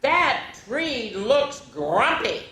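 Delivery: a lightly voiced nervous boy; boy's voice. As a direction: Ben eyeing the grumpy tree. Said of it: "Ben cried".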